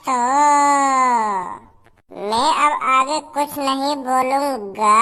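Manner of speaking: low, drawn-out monotone; maddeningly slow, low and level; slow teasing stalling. Acted. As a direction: hold every last word long to waste time. Stalling officer, says just passing time.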